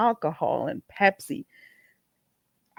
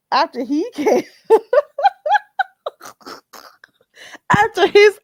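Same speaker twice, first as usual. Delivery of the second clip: laughing through words; 12 semitones higher, 7 dB louder than usual. Real, not acted. Laughs while mocking.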